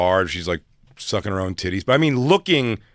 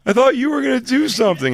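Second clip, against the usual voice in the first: much higher, louder mock whine; high whiny protest; 13 semitones higher, 5 dB louder than usual. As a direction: whine it like you got tricked. Retold disappointed protest.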